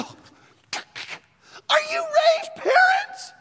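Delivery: high, loud, drawn-out holler; excited shouting. Retold or imitated. Excited fan calling to player's parents rows up, shouting. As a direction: yell it up the rows in amazement. Yelled question to parents in stands.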